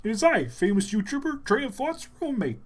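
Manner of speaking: high puppet frog voice; Kermit. Acted. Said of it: "makes you do the voice".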